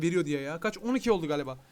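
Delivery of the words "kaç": normal talking voice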